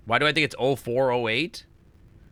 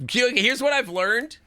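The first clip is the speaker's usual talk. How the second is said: much higher, slow, lifting at the end; rising questioning read; 9 semitones higher than usual. Real, not acted. Streamer reading the note aloud, rising questioning read. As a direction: stretch it upward like a question.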